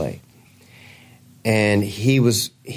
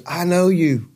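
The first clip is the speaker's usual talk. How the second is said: retold exclamation, pitched clearly higher; excited recognition; 6 semitones higher than usual. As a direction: play a delighted moment of recognizing someone. Doctor recognizes the singer, retold.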